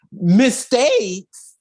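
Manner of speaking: high, drawn-out disbelief; incredulous. Real, not acted. Host scoffs at Zordon's excuse, incredulous.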